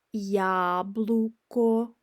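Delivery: Яблуко spelled out slowly, syllable by syllable; slow teacher read of Яблуко; slow demo. Shown as a demonstration. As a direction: draw out Яблуко for learners. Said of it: "you can say яблуко".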